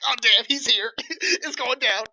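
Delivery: psyched for the boss fight; mock hyped. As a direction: player thrilled as the war chief arrives. War chief appears, player hyped.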